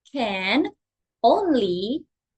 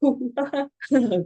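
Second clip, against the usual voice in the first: monkey noise imitation; 3 semitones higher than usual. Shown as a demonstration. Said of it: "Follow teacher".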